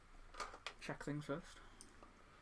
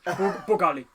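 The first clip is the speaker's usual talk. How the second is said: loud, hammed-up accented character voice; an awful put-on accent, called out loudly; Saw Gerrera; 9 dB louder, faster than usual. Player quoting a film character, in an awful put-on accent. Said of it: "All Saw Gerrera said was".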